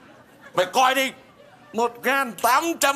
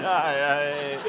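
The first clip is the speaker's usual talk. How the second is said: mock crying; much slower than usual. Father weeping over his pregnant daughter, mock crying wail. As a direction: wail theatrically, stretching every cry downward. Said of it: "bộ ba khóc hả".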